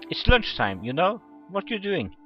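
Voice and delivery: loud, high, questioning call; calling after someone. Acted. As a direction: call down the hallway after a classmate. Acted dialogue, calling after Kotori.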